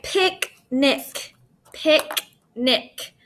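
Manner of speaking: "picnic" in two separate beats, pic, nic; syllable by syllable. Shown as a demonstration. Teacher finding the syllable break for pupils, split in two. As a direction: leave a gap inside "picnic", twice over. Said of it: "that's my syllable break".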